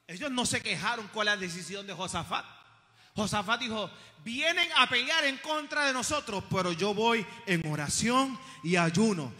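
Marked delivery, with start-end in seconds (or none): 4.2-9.3 loud, resolute preaching; forceful declaration. Acted. Preacher voicing the king facing attack, loud and resolute.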